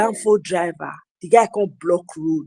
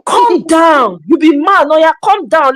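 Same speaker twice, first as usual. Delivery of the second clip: raised challenge; 6 semitones higher, 5 dB louder than usual. Retold or imitated. Father confronting the driver.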